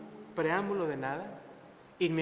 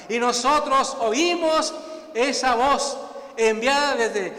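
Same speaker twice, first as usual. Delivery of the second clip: loud, raised-pitch preacher proclaiming scripture; preaching; 7 semitones higher, 10 dB louder than usual. Real, not acted. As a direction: preach it forcefully to the congregation. Preaches and reads scripture forcefully.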